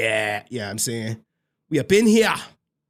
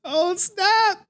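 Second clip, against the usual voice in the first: much higher squeal on snap; high shocked "Oh, snap". Real, not acted. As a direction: play up the shock. Reacting again louder.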